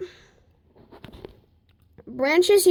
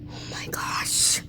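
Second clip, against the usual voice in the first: whispered awe; 14 semitones lower, 7 dB quieter, slower than usual. Real, not acted. Awed by ice damage.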